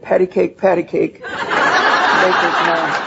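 dissolving into laughter on the last words, very slow; can't get through the rhyme for giggling; real giggle while quoting him; laughing through it. Retold or imitated. Quoting husband, then cracking up.

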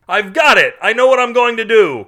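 loud, high-pitched eureka yell; high, loud burst of triumph; excited shout. A narrator's reading. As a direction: shout it out like a sudden brainwave. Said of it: "Carl shouted".

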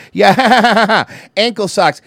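real laughter breaking through the words; laughing. Real, not acted. Cracking up retelling the ankle socks story, laughing loud.

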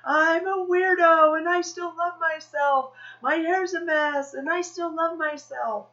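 high, drawn-out proclamation; slow, ringing declaration to a crowd; bold practiced affirmation. Acted. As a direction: sing out each flaw proudly. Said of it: "I'm just practicing saying these things".

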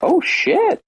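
sudden high yelp of real surprise; surprised. Real, not acted. Host hears the guest is Australian, shocked. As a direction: caught off guard, voice jumps way up.

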